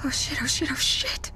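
frightened breathless whisper; panicked whisper. Acted. Cassie alone hears something, a frightened whisper. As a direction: hiss it in fear, barely voiced. Scared at a sudden noise.

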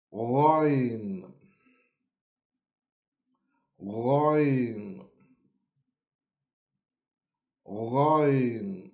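Ghain said with a strong gargled g; Arabic letter Ghain. Shown as a demonstration. Teacher models Ghain for learners. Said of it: "So we say".

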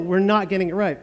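normal talking voice

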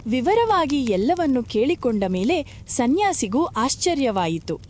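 plain reading voice

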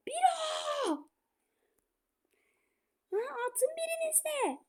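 high half-laughing plea; exasperated pleading. Real, not acted. Gamer begging his strikers to shoot, laughing exasperation. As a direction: beg them to just shoot.

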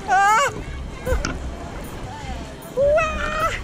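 ordinary talk, nothing marked

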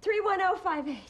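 high, urgent call of a number. Acted. Wife calls out the donor number.

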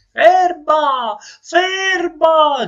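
very high, loud, stuffed-nose yell; shout. Acted. Man on balcony calls after his escaping nose, shouting high. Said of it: "gridò il signore".

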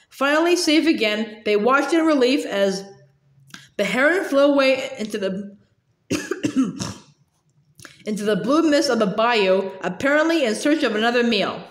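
usual speaking voice

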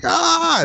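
high-pitched mock scream; drawn-out high "ah", acted out; imitated scream. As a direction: imitate a caller's sudden scream of pain. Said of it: "there's a moment where he screams".

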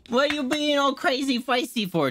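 high-pitched doting pet voice; pet talk. Real, not acted. Streamer teasing her feisty pet, high pet talk. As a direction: playfully coo a question at the pet.

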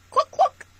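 hammed-up storytime chicken; mock chicken cluck. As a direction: cluck like a hen for the kids.

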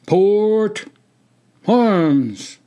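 high, loud drill order with a pause between words; hollered command. A narrator's reading. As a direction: holler it across the parade ground. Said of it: "and then hollered".

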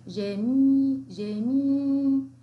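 teacher stretching the syllable جمي; stretched syllable drill. Shown as a demonstration. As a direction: sound out جمي slowly with a long final i. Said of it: "الميم لديها إيه؟".

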